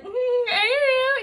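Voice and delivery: very high, loud, stretched-out mock squeal; awkward dodge. Answering a fiancé's wish question, awkward squeal. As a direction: imitate your awkward self squealing. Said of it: "an awkward human being".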